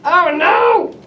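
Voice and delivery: mock excited; playful whee. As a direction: slide the toy with glee. Toy slides down.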